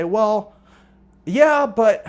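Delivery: agree, then balk; reluctant prospect. Acted. Prospect answering the trial close, reluctant.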